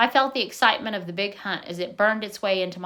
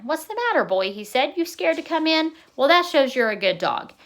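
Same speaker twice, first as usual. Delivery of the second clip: coaxing; 6 semitones higher than usual. A narrator's reading. Grandpa coaxing a shy dog.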